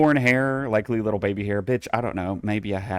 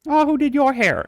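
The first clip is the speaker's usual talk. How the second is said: high, louder sassy aside; sassy quip; 6 dB louder than usual. Pretend. Teasing the quiz about hair color, high sassy. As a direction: toss off a mock diva remark.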